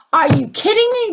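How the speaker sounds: high, exasperated disbelief at the sky; ranting disbelief. Retold or imitated. Speaker retelling her rant at God, high and exasperated. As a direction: throw the question up in outrage. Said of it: "rant and rave".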